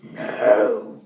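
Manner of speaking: breathy mock growl of a no; a gruff little wolf no. Mister Wolf growling no to the peddler woman. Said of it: "growled Mister Wolf".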